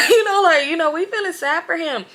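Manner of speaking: loud high laughter; laughing. Real, not acted. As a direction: laugh hard through it. Laughing crying.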